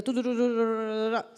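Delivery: rapid-fire nonsense syllables for skim-reading; lectura mecánica imitada. Shown as a demonstration. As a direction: rattle off "tu-tu-tu" like rushed reading. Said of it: "no estar así como leyendo". (tarareo atropellado de lectura apresurada)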